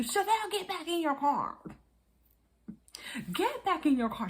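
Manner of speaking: high, strained imitation of yelling; mock yelling. Production team yelling at Chevelle to get back, mock yelling. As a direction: holler it high, mimicking the crew. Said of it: "Now they're yelling at you".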